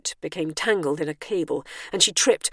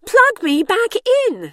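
very high, loud, slow order; more aggrieved demand; 9 dB louder, slower than usual. A narrator's reading. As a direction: insist loudly, pitch shooting up, each word drawn out. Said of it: "in a slightly more aggrieved tone".

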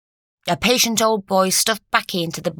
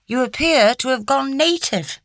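raised-pitch mock-solemn tease; mock seriousness; 7 semitones higher than usual. A narrator's reading. Doctor teases Lucy about her new ways, mock serious. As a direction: tease with pretended gravity. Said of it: "he said with mock seriousness".

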